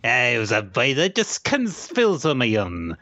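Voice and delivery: cartoon sailor impression; Popeye. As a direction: ham up the comic sailor voice. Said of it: "you have a great Popeye".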